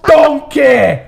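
high, slow, drawn-out ogre bellow; Shrek. Acted. Ogre finds donkey in his swamp, hammed-up impression. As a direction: confront an intruder like an outraged cartoon ogre. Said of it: "Shrek impression".

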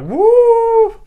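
high, loud victory whoop; cheering whoop. Retold or imitated. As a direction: whoop like you just won. Muscle Man cheering in story.

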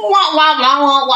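muffled trombone wah wah; muffled wah wah talk. Retold or imitated. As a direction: mimic the muffled adult voices. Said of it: "why do they have to talk like that".

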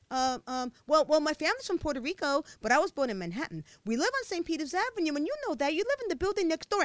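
fast, high anxious rush; nervous explaining. A narrator's reading. Michelle nervously answering the bully, fast flustered rush. Scared girl explaining herself.

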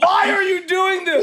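high, drawn-out wail of protest; horrified, wailing question; distressed plea. Real, not acted. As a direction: plead slowly, pitched right up. Pleading while watching gruesome video.

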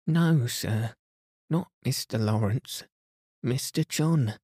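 high, upset correction; hurried and shaken; distressed maid. A narrator's reading.

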